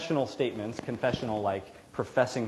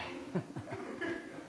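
breathy laugh; 8 semitones higher, 11 dB quieter than usual. Real, not acted. Laughs after voicing Jonah.

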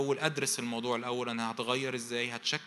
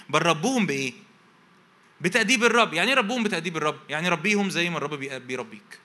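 raised, high, loud preaching voice; fiery preaching; 3 semitones higher, 8 dB louder than usual. Acted. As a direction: preach it out to the crowd with full force.

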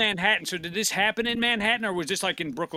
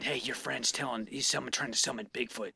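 whisper; 8 semitones lower, 8 dB quieter than usual. Retold or imitated. Voicing the spirit.

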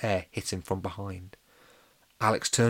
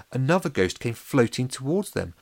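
plain reading voice

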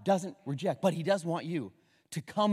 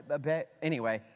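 usual speaking voice

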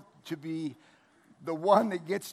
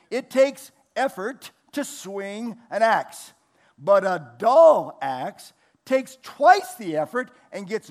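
emphatic preaching; 4 semitones higher, 6 dB louder than usual. Acted. Preaching on the verse.

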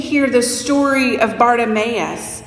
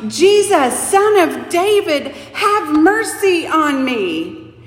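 raised, higher calling out for mercy; excited shout; 4 semitones higher than usual. Acted. Blind beggar calls out to Jesus, excited shout. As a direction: cry out to Jesus from the roadside. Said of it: "Can you hear the excitement in his voice as he shouts".

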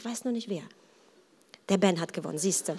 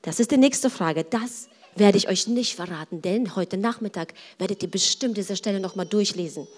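normal talking voice

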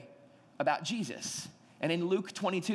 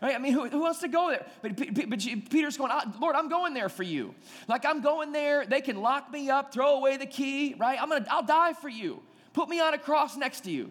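Peter's protest; 7 semitones higher than usual. Retold or imitated. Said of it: "I'd be going".

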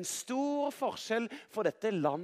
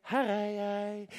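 Samuel answering. A narrator's reading. Woken boy reporting to the priest, held-out answer. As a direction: hold the answer out on one note.

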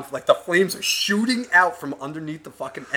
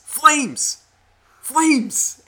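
high, loud yelled word from down the street; mock yell; 12 semitones higher, 5 dB louder than usual. Truck driver yelling at the driver, loud call. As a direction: shout it at the car ahead. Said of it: "I hear this yelling".

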